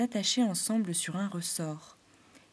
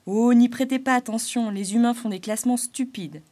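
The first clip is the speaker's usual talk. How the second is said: louder, higher jester voice; Arlequin reassuring; 4 semitones higher, 8 dB louder than usual. Acted. Clown reassuring the animals, louder. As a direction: brush it off for them. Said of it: "continua Arlequin".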